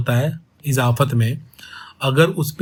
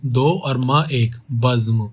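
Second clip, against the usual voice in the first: "दो और म एक" counted out slowly; slow counting of beats; slow syllable count; 2 semitones lower, slower than usual. Shown as a demonstration. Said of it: "बज्म की जो मात्रा होगी वो क्या".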